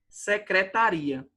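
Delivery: "Secretaria" slowly, stressed on RI. Shown as a demonstration. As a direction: say "Secretaria" with the accent moved to RI, ri-a apart. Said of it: "ela não for tônica".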